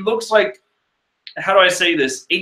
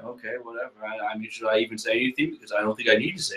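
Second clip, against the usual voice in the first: muttered aside; 6 semitones lower, 10 dB quieter, much faster than usual. Real, not acted. Muttered aside about Nami.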